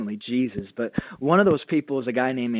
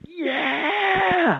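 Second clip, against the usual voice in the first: eager Antonio shouting. Retold or imitated. Pastor imitating Antonio's joyful answer, very high excited shout. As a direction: burst out the answer with pure joy. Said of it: "And he's like".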